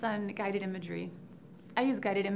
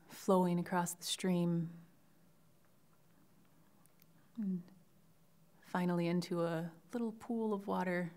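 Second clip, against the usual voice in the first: guided imagery. Shown as a demonstration. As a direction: lead the class gently through it, phrase by phrase. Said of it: "give you an example of that now".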